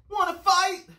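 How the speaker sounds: high, loud dare; pugnacious bug challenge; grouchy ladybug. A narrator's reading. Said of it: "said the grouchy ladybug".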